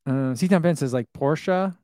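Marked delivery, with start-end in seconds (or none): 1.1-1.8 "Porsche" as POR-shuh; "Porsche" ending in "shuh"; two-syllable Porsche. Shown as a demonstration. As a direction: say "Porsche" with a final uh. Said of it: "I say both".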